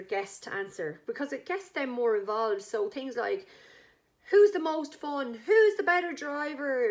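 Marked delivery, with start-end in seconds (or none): 4.3-6.9 higher, louder slow quiz questions; game host questions. Retold or imitated. Hosting the wedding game.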